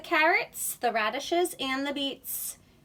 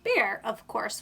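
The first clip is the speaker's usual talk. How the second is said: ordinary delivery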